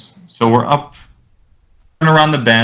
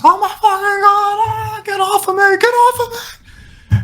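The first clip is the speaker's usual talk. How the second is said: very high thin squealed panic; imitated panicked scream. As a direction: mock-scream as if being attacked. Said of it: "starts screaming at the top of his lungs".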